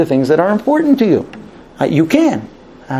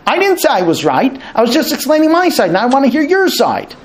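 higher, exasperated husband protesting; frustrated husband; 9 semitones higher than usual. Retold or imitated. Husband defending himself to his wife, exasperated. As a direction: insist you only explained, rising in pitch. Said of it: "the man gets really frustrated".

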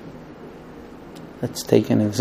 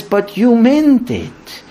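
very slow, higher put-on voice; wife's retort; 7 semitones higher, much slower than usual. Wife answers husband, slow pointed retort. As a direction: draw out the accusation. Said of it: "And the wife says".

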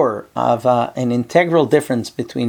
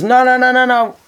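insistent no's; 8 semitones higher than usual. Retold or imitated. Student refuses another rabbi.

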